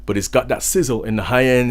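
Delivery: usual speaking voice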